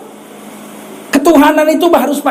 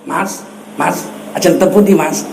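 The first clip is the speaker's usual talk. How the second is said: soft, polite Javanese accent, lower; 6 semitones lower, 4 dB quieter than usual. Shown as a demonstration. Preacher demonstrates Javanese spoken properly, lower and soft Javanese accent. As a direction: greet like a courteous Javanese villager, low and gentle. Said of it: "pakai logat Jawa".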